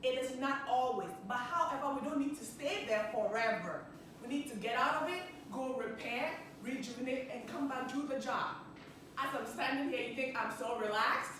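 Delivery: ordinary delivery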